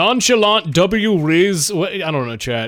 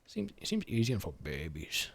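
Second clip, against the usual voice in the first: much softer, muttered repeat; quiet repeat; 11 semitones lower, 19 dB quieter than usual. Shown as a demonstration. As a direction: say it again under your breath.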